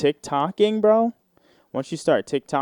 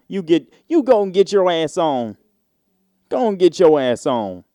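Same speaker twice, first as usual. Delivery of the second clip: sassy loud command; 4 semitones higher, 6 dB louder than usual. Retold or imitated. Greeter voiced shooing a girl.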